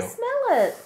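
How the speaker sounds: coaxing the cat. Acted.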